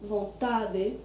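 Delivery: vontade ending in a hard deh; regional variant. Shown as a demonstration. Teacher shows another regional accent, vontade with plain deh ending. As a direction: say vontade as von-TAH-deh, lightly. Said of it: "You may hear vontade".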